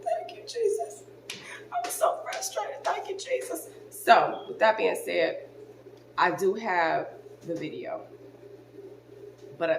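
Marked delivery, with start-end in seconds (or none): 0.0-3.7 much higher, tearful, breaking voice; retelling her weepy relieved thank-you with the voice cracking up high; crying thanks. Retold or imitated. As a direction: squeak out the thanks through tears of relief. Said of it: "I start crying".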